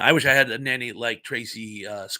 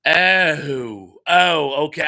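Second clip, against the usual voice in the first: a louder "Oh, oh, okay" as the joke finally lands; sudden realization; 2 semitones higher, 7 dB louder, much slower than usual. Real, not acted. Streamer realizing a viewer's comment was a pun, caught out. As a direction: catch on all at once, a bit sheepish.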